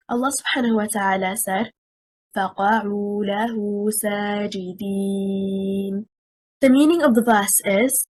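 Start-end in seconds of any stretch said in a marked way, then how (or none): 2.4-5.9 very fast melodic recitation of فَقَعُوا لَهُ سَاجِدِينَ; Quran recitation. Acted. Teacher quoting a verse in recitation style.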